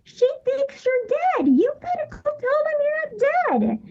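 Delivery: urging the cousin to tell the family, high and tearful; tearful plea. Retold or imitated. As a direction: plead with him, voice cracking. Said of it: "And I'm like".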